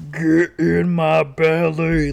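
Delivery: loud, slow growly movie-monster voice; put-on silly voice. Shown as a demonstration. Copying the kid's requested voice, slow loud put-on voice. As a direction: drag it out loudly in a silly voice. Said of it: "Like that?".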